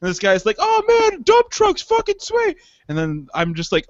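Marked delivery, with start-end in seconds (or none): 0.6-2.6 put-on excited kid. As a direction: shout like a thrilled boy with a toy car. Kid voiced playing with cars.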